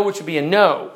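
ordinary talk

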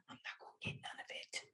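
whispered, fast and flat. Shown as a demonstration. S0 showing how no passion sounds, a fast whisper. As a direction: drop to a whisper, no energy. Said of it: "But if you're not passionate".

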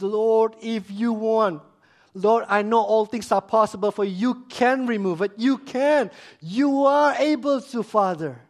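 acted plea, climbing in urgency; pleading prayer. Acts Jesus pleading with his Father.

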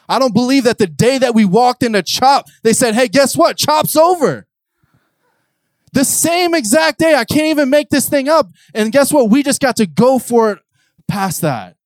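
high, loud preaching build; full-force preaching. Acted. Preacher telling the crowd the day chop ended, full force.

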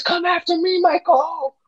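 very high falsetto imitation; mock girl's taunt. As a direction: squeal it as a hammed-up challenge. Voicing girl taunting Michael.

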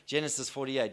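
ordinary delivery

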